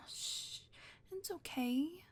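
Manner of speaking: ordinary talk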